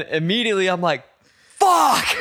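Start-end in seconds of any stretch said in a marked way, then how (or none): 1.6-2.2 high, loud cry of pain; re-enacted scream of pain; pained yell. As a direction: yelp the curse as the ankle gives way. Acting own reaction to ankle injury.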